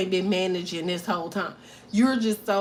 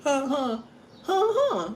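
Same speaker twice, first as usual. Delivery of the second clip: creepy chuckle; 4 semitones higher, slower than usual. Acted. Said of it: "Intrusive thought".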